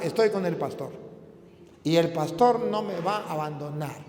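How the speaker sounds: ordinary talk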